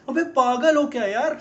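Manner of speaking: loud, high, drawn-out exasperation; exasperated outburst. Acted. Ranting man asks if his girlfriend is crazy, exasperated. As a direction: raise your voice in slow, incredulous exasperation.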